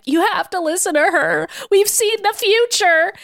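high, strained mock pleading; hammed-up desperate hero; Scott. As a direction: plead melodramatically, pitched well up, slowing at the end. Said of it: "collapsed on the floor being like".

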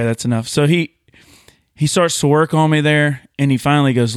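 ordinary talk

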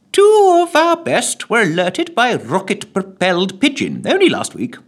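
high, eager official; secretary. Acted. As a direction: report proudly.

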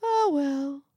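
high, loud breezy dismissal; fussy visitor's light "oh well"; airy little man. Acted. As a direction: brush it off in a lilting high voice. Little man airily dismisses the grumble.

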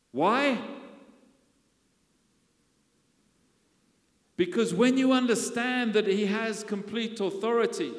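full-force preaching. Acted. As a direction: build the congregation up with rising fire.

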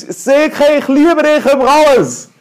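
laut und viel höher quer durchs Haus rufen; brüllen durchs Haus. Retold or imitated. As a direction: eine übermütige Liebeserklärung, lauthals gebrüllt. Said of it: "dann brüll ich ins Haus". (verliebter Mann brüllt seiner Frau Liebe durchs Haus zu, laut)

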